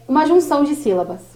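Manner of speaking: ordinary talk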